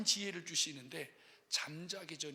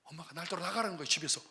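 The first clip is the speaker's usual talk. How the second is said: ordinary talk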